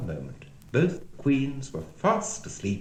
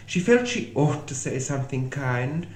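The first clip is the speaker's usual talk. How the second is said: usual speaking voice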